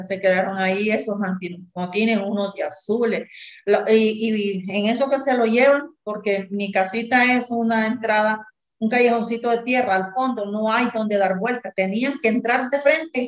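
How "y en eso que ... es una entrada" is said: ordinary delivery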